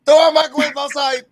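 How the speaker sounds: playful shout. Acted. Joking shout.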